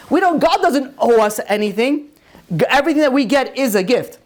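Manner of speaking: high-pitched, urgent sermon delivery; fervent rabbi driving the point home; impassioned preaching. Real, not acted. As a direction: press the moral on the congregation. Preaches that all is a gift.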